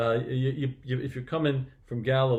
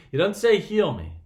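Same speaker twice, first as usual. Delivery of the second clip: raised, higher-pitched insistence; loud emphatic point; 6 semitones higher, 8 dB louder than usual. Real, not acted. Preacher stressing what the blind man didn't ask, loud and emphatic. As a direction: drive the point home loudly.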